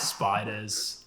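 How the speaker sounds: tossed-in fake Australian grumble; mock Australian accent. Co-host adding an Australian hazard, mock Australian accent. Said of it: "Everything kills you in Australia".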